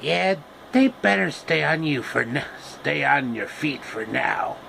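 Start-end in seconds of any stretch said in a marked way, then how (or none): none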